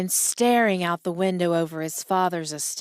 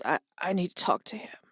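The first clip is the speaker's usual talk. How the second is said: faint whisper; 4 dB quieter, faster than usual. Acted. Dying father asks for his son.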